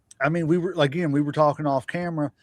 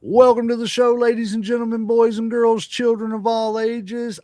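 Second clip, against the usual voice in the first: ring announcer; 5 semitones higher than usual. Acted. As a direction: welcome the crowd like a ring announcer. Ring announcer style intro.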